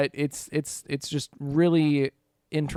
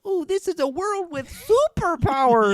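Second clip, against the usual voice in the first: much higher, giddy mock excitement; mock wide-eyed wonder; 5 dB louder than usual. Fan mimicking the early show's innocence, high giddy mock wonder. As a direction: gush like a kid discovering superpowers. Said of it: "kind of like".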